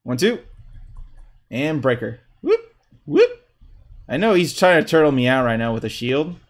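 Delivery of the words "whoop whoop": quick high rising whoop; little upward whoops, very high; playful whoop. Real, not acted. Player sounding out moves in a match, playful whoop. Vocal effects for game moves.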